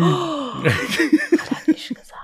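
slow whispered aside with a laugh. Real, not acted. Teasing her co-host behind his back, slow laughing whisper. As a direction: breathe it out as a giggling aside.

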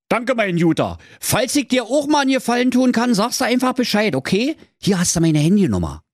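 high, chatty Berlin voice; grateful huntsman. A narrator's reading. Huntsman thanks the piglet for taking the stones, grateful voice. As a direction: thank him in high, quick Berlin dialect. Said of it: "Und der Jägermeister sprach".

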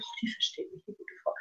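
whispered thought of the customer; customer's subconscious, whispered; whispered inner thought. Retold or imitated. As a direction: breathe it out as an inner voice.

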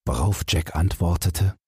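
usual speaking voice

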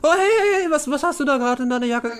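high, excited nosy cellmate; prying prisoner. Acted. Prisoner spotting something in cellmate's jacket, excited and prying. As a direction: pounce on it with eager curiosity.

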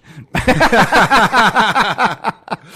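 high burst of loud laughter. Real, not acted. Big laugh at guard walking off.

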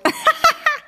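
sudden very high laughing squeal; a real, shrieking giggle; high squeal of laughter. Real, not acted. Joke about carrying the dolls.

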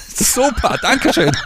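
high, giggling thanks; laughing. Real, not acted.

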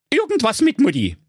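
high, dry owl doctor. A narrator's reading. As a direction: deliver it like a bored shrink. Owl gives diagnosis.

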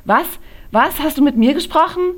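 high, loud and fast, caught off guard; startled shout. A narrator's reading. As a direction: jump as if snapped out of a daydream. Friend Silly startled, calls back. (Freundin fährt erschrocken hoch, laut und hoch)

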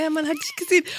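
high giggly mock bluff; amused retelling, voice lifted high; laughing repeat. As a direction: insist on it through laughter. Repeats silly bluff amid laughter.

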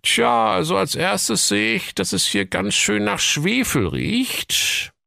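high, officious cop voice; police commissioner voice. Acted. (hohe, wichtigtuerische Kommissarstimme)

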